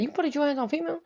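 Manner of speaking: soft, high-pitched disbelief; quietly imitated incredulous friend; appalled question. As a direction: echo the accusation in a hushed, retold shock. Friend's question, repeated softer.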